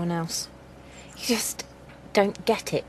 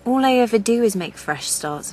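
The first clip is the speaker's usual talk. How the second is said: louder, higher, exasperated; bitter frustration; frustrated outburst; 5 semitones higher, 6 dB louder than usual. Acted. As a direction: burst out, fed up with herself.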